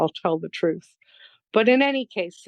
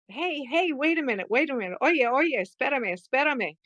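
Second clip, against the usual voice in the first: high-pitched, held-back mock calling out; retold yell; 6 semitones higher than usual. Woman calling after a hungry man down the block, mock yell. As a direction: imitate yelling down the block without real volume. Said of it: "I start yelling".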